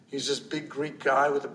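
ordinary delivery

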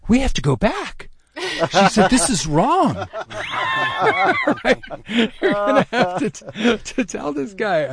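wife in combat mode. Retold or imitated. Wife, still fired up from the argument, insisting he go back. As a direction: press him to go back. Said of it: "still in kind of combat mode".

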